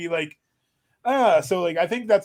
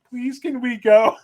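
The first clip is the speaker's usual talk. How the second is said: mock pleading; 6 semitones higher, 6 dB louder, slower than usual. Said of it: "I'm like".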